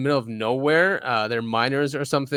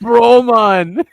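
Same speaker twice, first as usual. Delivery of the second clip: higher, louder mock Canadian "Bro, man"; Canadian accent; 8 semitones higher, 9 dB louder than usual. Cohost mimics the miner's Canadian catchphrase in mock accent. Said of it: "most Canadian video".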